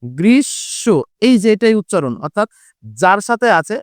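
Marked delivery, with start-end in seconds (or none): 0.0-0.8 গ্রীষ্ম with the শ doubled and nasalised; গ্রীষ্ম: double the শ, nasal on the second; pronunciation demo. Shown as a demonstration. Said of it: "এই শব্দটার উচ্চারণ কিন্তু হচ্ছে". (গ্রীষ্ম উচ্চারণ, শ দুইবার, চন্দ্রবিন্দুসহ)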